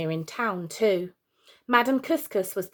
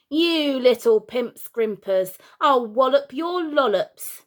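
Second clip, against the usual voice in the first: slow, louder, higher threat; menacing father; 5 semitones higher, 6 dB louder, slower than usual. Acted. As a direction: draw out each insult like a bully. Father chasing children with hose.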